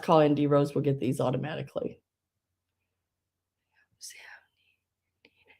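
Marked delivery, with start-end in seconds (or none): 4.0-5.6 soft unvoiced whisper, slow. Real, not acted. Seller reading a comment to herself, whispering. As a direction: whisper it to yourself.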